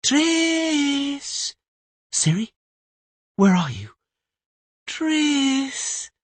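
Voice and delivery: put-on high, far-carrying call for a lost girl. Tris calling for Siri across the sea.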